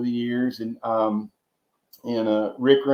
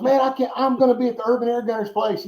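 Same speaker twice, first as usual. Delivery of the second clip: high, giddy retelling of the friend's glee; a pitched-up, lightly voiced fan geeking out; imitating excited friend; 11 semitones higher, faster than usual. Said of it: "And he goes".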